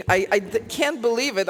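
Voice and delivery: ordinary talk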